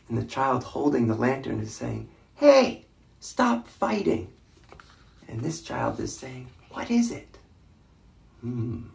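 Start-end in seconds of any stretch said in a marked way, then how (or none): 2.3-4.3 put-on high, loud child's protest; child calling out. A narrator's reading. Child holding the lantern, calling out. 6.7-7.4 put-on high-pitched child asking; child's voice. A narrator's reading. Child in the story, asking in a high voice.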